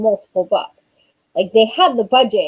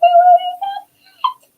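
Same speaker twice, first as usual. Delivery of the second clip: very high, loud doting-mom squeal; hammed-up, high-pitched adoration; gushing affection; 4 dB louder, faster than usual. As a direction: gush back at your adoring kid, pitch way up. Acts out gushing reply to son.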